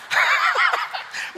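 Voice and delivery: a sudden high burst of laughter. Real, not acted. Pastor laughing at his own joke about other preachers, high burst of laughter.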